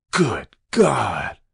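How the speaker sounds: a mild hoarse, half-choked cry of terror. A narrator's reading. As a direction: cry it out, barely able to form the words. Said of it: "he cried hoarsely, almost inarticulately".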